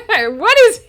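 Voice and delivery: loud, drawn-out baffled cry through laughter; laughing bewilderment. Real, not acted. Streamer baffled by the chat, laughing bewilderment.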